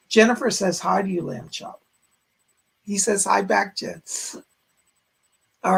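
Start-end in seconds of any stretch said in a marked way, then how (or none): 1.2-1.8 hushed aside. Pretend. Addressing puppet.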